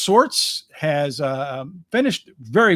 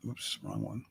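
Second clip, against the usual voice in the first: muttering to himself; 7 semitones lower, 20 dB quieter than usual. Real, not acted.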